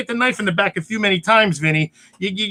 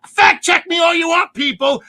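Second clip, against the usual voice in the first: louder, higher dare to the audience; defiant shout; 6 semitones higher, 4 dB louder than usual. Real, not acted. Daring listeners to fact check him, loud defiance. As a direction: throw down the challenge loudly.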